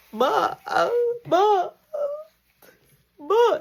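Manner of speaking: high crying ma; imitating sobbing. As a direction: blubber it out like a scared kid. Son crying to mom.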